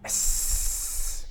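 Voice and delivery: drawn-out unvoiced spider hiss; a long spider hiss at the adventurers. A narrator's reading. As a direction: hiss like a spider baring fangs. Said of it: "lets out a long hiss".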